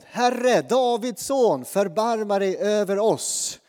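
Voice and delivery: loud, slow, pleading cry; blind men crying out. Retold or imitated. Blind men on the road crying out to Jesus, loud and pleading. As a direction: call out to Jesus for mercy, drawn out. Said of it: "här ropar de".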